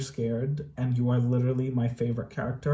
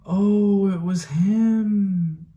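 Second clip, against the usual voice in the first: moved; 7 semitones higher, 3 dB louder, much slower than usual. Real, not acted. Moved by final reveal.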